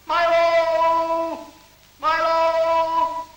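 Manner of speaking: high, drawn-out loud cry; calling out. Acted. Someone crying out for Milo, long high call. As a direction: stretch the name in a high call.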